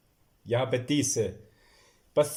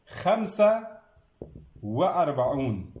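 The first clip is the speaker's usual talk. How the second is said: teacher calling the next number; called out number; slower than usual. Shown as a demonstration. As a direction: announce the number, then dictate slowly. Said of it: "dictating numbers to class".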